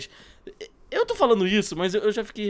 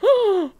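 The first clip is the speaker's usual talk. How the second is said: sharp surprised gasp; 13 semitones higher, 4 dB louder than usual. Real, not acted. Dragon appears suddenly.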